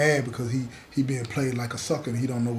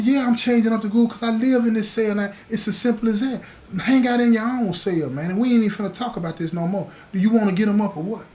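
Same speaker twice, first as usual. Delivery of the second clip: raised, challenging voice, pitch up; heated confrontation; 8 semitones higher, 5 dB louder than usual. Retold or imitated. To the young cellmate hanging out in his cell, angry challenge. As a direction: square up and call him out loud.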